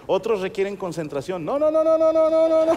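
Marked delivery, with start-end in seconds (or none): 1.4-2.8 high, loud, rapid string of no's; panicked loud no no no; frantic refusal. Acted. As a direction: refuse like your life depends on it. Audience refusing to imagine it.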